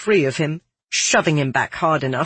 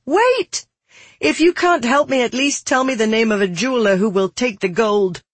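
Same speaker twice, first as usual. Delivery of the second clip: high, sharp cry easing into a plea; urgent calling out; 8 semitones higher, 3 dB louder than usual. Acted. Girl calls after Milaka as she shuffles away, desperate. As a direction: call out desperately, then keep pleading.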